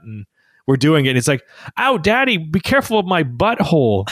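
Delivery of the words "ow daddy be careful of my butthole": put-on high whiny little kid voice; dad voicing his son on the potty; toddler voice. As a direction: imitate a small child complaining.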